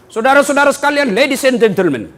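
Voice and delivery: loud, high announcer introducing a guest; mock emcee. Mock emcee welcoming the regent, hammed-up and loud. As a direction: ham up a stage welcome, drawing out the name.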